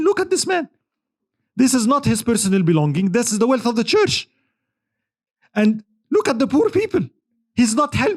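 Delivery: high, outraged protest, mildly acted; high-pitched indignant retelling; indignant scholar. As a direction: point at the greed in disbelief.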